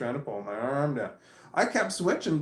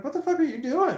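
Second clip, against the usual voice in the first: high-pitched exasperated question; indignant retort; 12 semitones higher than usual. Retold or imitated. Man to woman pulling his arm down, indignant. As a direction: ask it in rising disbelief.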